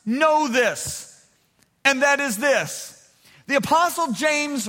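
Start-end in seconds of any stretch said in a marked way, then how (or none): none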